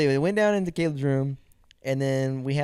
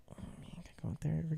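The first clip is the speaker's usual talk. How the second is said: quick soft begging; very soft quick whine; hushed eager request; pleading under breath; 3 semitones higher, 17 dB quieter, faster than usual. Retold or imitated. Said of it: "I'm like".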